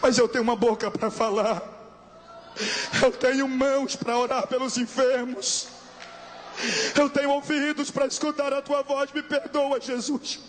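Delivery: high, strained cry of a repentant plea, slow on me perdoa; tearful pleading prayer. Retold or imitated. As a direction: beg forgiveness through retold tears. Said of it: "Eu comecei a chorar e falei". (súplica chorosa, aguda e forte)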